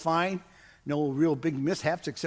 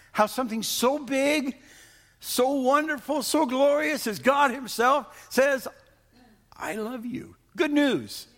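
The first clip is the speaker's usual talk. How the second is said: high and loud, building to a cry; preaching at full force; 9 semitones higher, 3 dB louder than usual. Acted. Pastor proclaiming God's love to the congregation, high and loud. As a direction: lift the room higher on each line.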